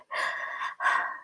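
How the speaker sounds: soft, voiceless tearful exhale; tearful sigh. Real, not acted. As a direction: breathe out to hold back tears. Trying not to cry.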